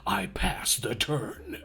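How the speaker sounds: slow, hushed dramatic trailer read; low breathy voice-over, drawn out; movie trailer voice. Pretend. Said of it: "you're supposed to say".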